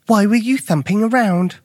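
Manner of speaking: put-on high, questioning boy's voice; inquisitive young Luke. A narrator's reading. Luke asks Alfred about the noise, puzzled.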